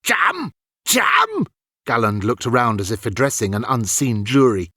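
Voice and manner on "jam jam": high, climbing incredulous echo; baffled disbelief. A narrator's reading. Galland baffled by Ilian's slang, high incredulous echo. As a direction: repeat it like it's nonsense.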